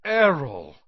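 loud, high exclamation that falls away; alarmed cry. A narrator's reading. Boy calls out to his crashed owl, alarmed cry. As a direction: cry out the name in dismay.

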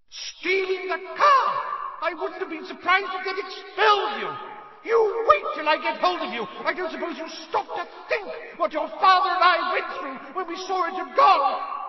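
shrieking, very high mother's tirade; furious yelling. A narrator's reading. Mother's howler berates son for taking the car, furious yelling. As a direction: bellow a scolding at full force, screeching. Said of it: "Missus Weasley's yells, a hundred times louder".

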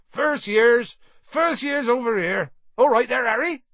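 high, loud shout over a crowd; calling out. Acted. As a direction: holler to round up the new students. Hagrid calls out to new students.

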